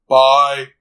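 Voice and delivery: loud robotic monotone; mock robot farewell, stretched out; Soundwave. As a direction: drone it like a machine. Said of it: "it's like".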